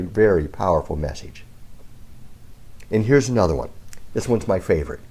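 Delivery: ordinary talk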